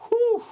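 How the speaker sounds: very high, loud whew; a high, loud whew for the impact; imitated whoosh. Imitated noise for being hit.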